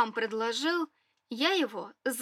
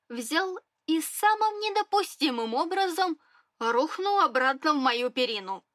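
ordinary delivery

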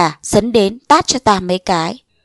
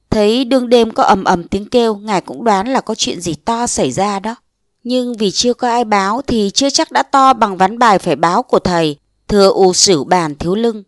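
plain reading voice

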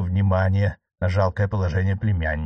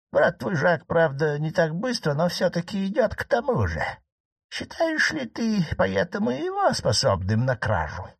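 old marquise, voiced higher, lightly ironic; 8 semitones higher than usual. Aunt teasing her nephew the judge, voiced higher. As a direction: needle the judge in a higher aunt's voice.

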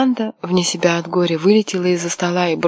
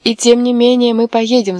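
loud, higher defiant shout; 5 semitones higher, 6 dB louder than usual. A narrator's reading. Миранда кричит на мужа: громкий вызывающий крик. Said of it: "закричала она".

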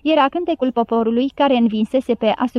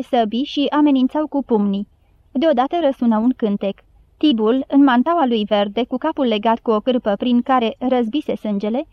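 ordinary delivery, nothing marked

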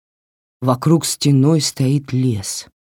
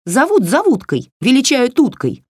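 higher, snippy doll comeback; snapping doll; 10 semitones higher than usual. Acted. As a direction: snap it back like a cheeky doll. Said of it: "огрызнулась кукла". (дерзкий огрызающийся голосок куклы)

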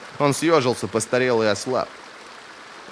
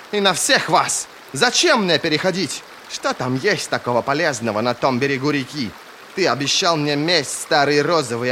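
acted outburst, higher and louder; angry growling tirade; 6 semitones higher, 4 dB louder than usual. A narrator's reading. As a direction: hurl angry accusations and demand answers. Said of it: "прорычала Ферро". (гневная тирада, громко, на повышенных тонах)

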